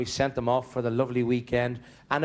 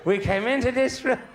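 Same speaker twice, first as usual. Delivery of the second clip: wife reminds Seamus of their wedding night; old wife's punchline; 10 semitones higher than usual. Retold or imitated. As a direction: finish with a cheeky old wife's bawl.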